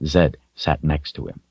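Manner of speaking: plain reading voice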